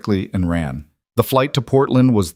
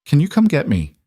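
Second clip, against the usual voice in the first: small broken voice; 7 semitones higher than usual. Acted. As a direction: ask to be rescued, frightened and hurting. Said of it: "Lily's voice was small and broken".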